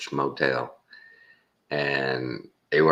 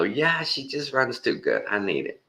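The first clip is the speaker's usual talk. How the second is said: high, silly car-buyer line; mock car buyer; 10 semitones higher than usual. A hint of a buyer talked into a car. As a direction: play a buyer who falls for it. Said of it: "I'm not gonna go".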